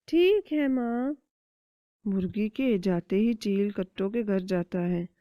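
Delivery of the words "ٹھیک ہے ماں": much higher, eager little chick; small child chick voice. Acted. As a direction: ask mother with childish curiosity. Chick agrees.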